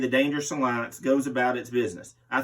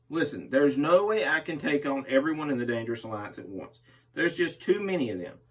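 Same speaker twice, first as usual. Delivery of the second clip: ordinary talk, nothing marked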